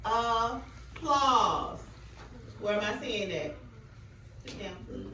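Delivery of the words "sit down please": low, firm classroom aside; calm quiet correction; quiet authority. Real, not acted. Teacher quietly correcting a student.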